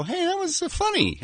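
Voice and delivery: high-pitched mock discovery; imagined surprised reaction. Interviewer imagining the director's reaction to his audience, mock surprised. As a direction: pitch it up high, as if just noticing.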